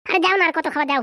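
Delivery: ordinary talk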